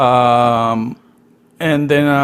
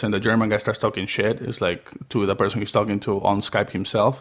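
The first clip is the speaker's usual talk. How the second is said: ordinary delivery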